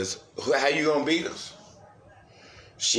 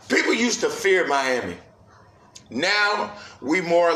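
excited rant; 7 semitones higher, 3 dB louder than usual. Real, not acted. Fan ranting about team's loss.

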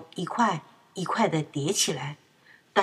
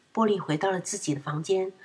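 usual speaking voice

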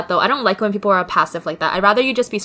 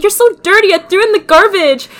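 higher, louder mock mom scolding; 9 semitones higher, 4 dB louder, faster than usual. Mom scolding her daughter over moldy mushrooms, in a hammed-up voice. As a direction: imitate mom telling her off.

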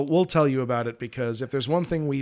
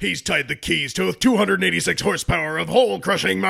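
monster truck ad; 4 semitones higher, 6 dB louder than usual. Acted. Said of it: "let me try it again".

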